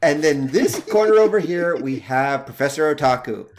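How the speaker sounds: loud, rising ring announcer hype; booming fighting game announcer. Pretend. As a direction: call out the fighters like a fight-night host. Announces a mock fight intro.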